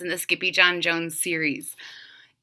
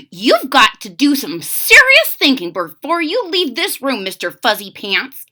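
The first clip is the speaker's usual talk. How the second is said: a stern mother's lecture, a touch louder; 4 semitones higher, 6 dB louder than usual. A narrator's reading. Mother lecturing her son in his room, stern. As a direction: lecture him firmly. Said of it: "said his mother".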